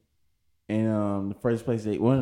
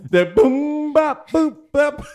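squeaky high beep-boop sounds; high alien noises; 7 dB louder, much slower than usual. Pretend. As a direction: chirp like a cartoon alien. Imitating alien mating noises.

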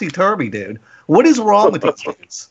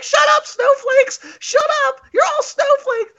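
shrill, much higher, slow mock screech; mock screaming. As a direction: screech it like a meltdown. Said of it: "they're screaming".

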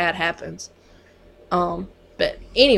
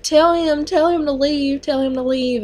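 frightened plea; 7 semitones higher than usual. Retold or imitated. Patient's frightened plea, retold.

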